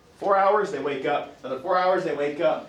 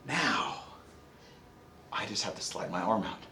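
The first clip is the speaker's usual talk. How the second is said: soft, hushed self-talk; whispered relief; 7 semitones lower, 9 dB quieter than usual. Retold or imitated. Parent sneaking the arm out from under the sleeping baby, hushed relief. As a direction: keep it hushed so the baby stays asleep. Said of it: "And I'm like".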